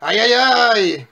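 loud, drawn-out ow; crying out. Acted. Wolf fails to knock down brick house, pained cry. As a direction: yelp in exaggerated pain, high and long. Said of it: "cria le loup".

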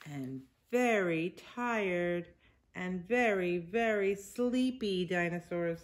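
patient picture-book narration; reading to children. A narrator's reading. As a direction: read it aloud to little kids, drawing out the words.

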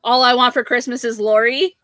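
usual speaking voice

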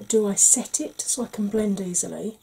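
ordinary talk

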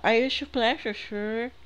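high mock female game voice; imitando voz feminina. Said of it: "Essa voz da, da, do negócio aí, da mulher". (imitação aguda da mulher do jogo)

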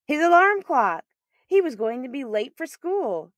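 high, loud, sudden alarm; startled exclamation. A narrator's reading. Narrator as the alarm clock jolts Daryl awake, startled loud cry. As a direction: jolt awake with the line.

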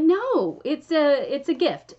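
mock-dramatic gracious refusal; dramatized inflection. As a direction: play up her kind refusal, a touch hammed. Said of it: "I don't know if you said it with that inflection, but I'm adding that".

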